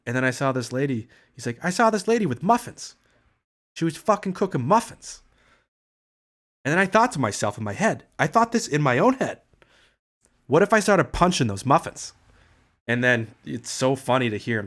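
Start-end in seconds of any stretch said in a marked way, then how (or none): 1.6-5.2 put-on higher, louder Boston accent, grumbling like Bill Burr; an annoyed Boston guy griping about a market trip. Streamer doing Bill Burr's Boston accent, griping about the market. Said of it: "when I do Boston accent, I try to talk like Bill Burr". 6.6-12.1 put-on louder Boston accent, musing like Bill Burr; a Boston guy confessing a silly thought. Streamer retelling the Bill Burr bit in a Boston accent. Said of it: "when I do Boston accent, I try to talk like Bill Burr".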